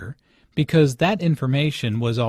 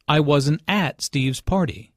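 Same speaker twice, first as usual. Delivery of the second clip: "at" stressed in "I wasn't at Steve's party"; emphasis on AT. Shown as a demonstration. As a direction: lean on "at" to mean there. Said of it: "the emphasis here is on at".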